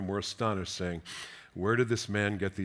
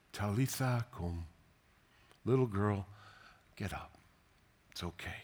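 soft, hushed, fading to very quiet; low-key, comforting murmur; tender reassurance; 3 semitones lower, 5 dB quieter than usual. Acted. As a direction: gently soothe a child awake, trailing softer. Voicing Jesus gently waking the girl.

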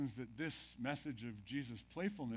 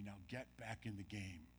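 quiet repeat; 6 semitones lower, 8 dB quieter than usual. Acted. Repeating Jesus' call.

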